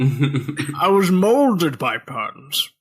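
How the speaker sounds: slow, grandiose masked villain voice; lightly voiced Bane, slow and theatrical. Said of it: "I was trying to do Bane".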